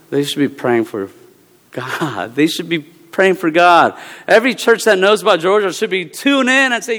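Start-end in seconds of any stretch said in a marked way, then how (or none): none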